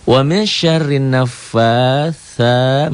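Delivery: "naffatsa" with long, drawn-out a vowels; mad asli. Shown as a demonstration. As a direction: chant "Wa min syarrin naffatsa" high and very slow. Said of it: "mad asli". ("naffatsa" dilantunkan panjang)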